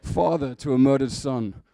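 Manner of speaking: loud, solemn film-hero proclamation; Russell Crowe. Acted. Preacher resuming his Gladiator bit, in a booming heroic voice. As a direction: declaim it like an avenging general. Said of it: "That's quite Russell Crowe".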